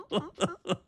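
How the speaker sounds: squeaky laugh bursting out; laughing at a friend's hidden softness; high squeal of laughter. Real, not acted. Laughing at own tease.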